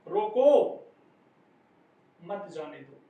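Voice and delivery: long silence after "रोको", the rest falling away; pause after रोको. Shown as a demonstration. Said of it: "रोको के बाद में यति हुई है".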